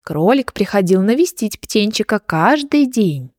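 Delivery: ordinary delivery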